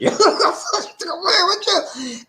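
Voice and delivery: high, loud laughing outburst. Real, not acted. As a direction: burst out laughing, pitch shooting up. Laughs at own church remark.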